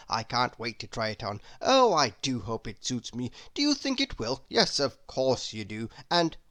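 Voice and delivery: breathless, eager chatterbox rambling on; a silly, delighted gossip who can't stop talking; chattering Mr Chatterbox. Acted. Mr Chatterbox babbling about hat.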